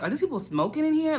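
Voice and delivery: high disbelieving ask; shocked question. Retold or imitated. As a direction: act out the shock of walking in on it. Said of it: "and one time I was like".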